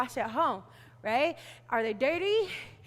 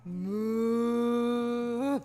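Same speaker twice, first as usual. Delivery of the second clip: long, sustained moo; cow moo; 2 semitones lower than usual. Shown as a demonstration. Teacher invites kids to make cow sounds, long moo. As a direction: hold out a cow's moo. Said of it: "And cow".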